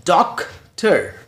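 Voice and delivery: "डॉक्टर" as DOC, pause, TER; split into syllables. Shown as a demonstration. Teacher counting two syllables for learners, sounded out slowly. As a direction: slowly break "डॉक्टर" into two separate beats. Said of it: "break करते हैं, तोड़ते हैं".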